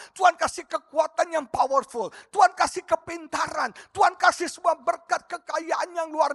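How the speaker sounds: loud, high, insistent sermon delivery; fervent preaching. Real, not acted. Preacher rebuking a congregation for a clogged life, fervent preaching. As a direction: drive each point home at full voice.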